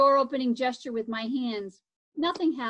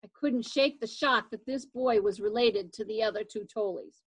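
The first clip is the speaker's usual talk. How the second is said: ordinary delivery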